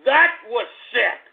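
ordinary talk, nothing marked

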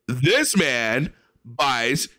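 very slow, word by word; slow emphatic irony. Pretend. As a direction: lay it on slowly for effect. Ironic emphatic statement.

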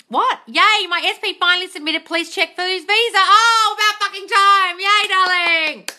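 loud, high-pitched cheering; excited celebration. Real, not acted. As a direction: build to a big, drawn-out whoop. Celebrating a viewer's long-awaited news.

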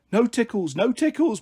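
put-on high, playful little-girl plea; begging. Little sister being tickled by Mum, begging. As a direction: beg like a child being tickled. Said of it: "Bliss begged".

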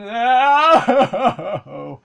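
loud high burst of laughter. Real, not acted. Laughing at failure.

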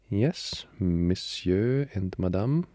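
slow, put-on French accent; French maid accent. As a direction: play an imagined French servant. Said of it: "I imagine them to be French".

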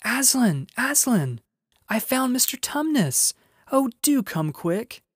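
put-on high, excited girl's shout; a girl shrieking joyful news upstairs. A narrator's reading. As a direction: call out in a high, thrilled voice. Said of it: "shouting out".

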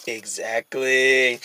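low, soft, slow threat; menacing pig. Acted. Pig back from the spell threatening rabbit, menacing. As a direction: drawl it low and quiet.

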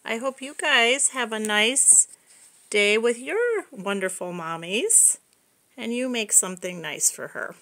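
ordinary delivery

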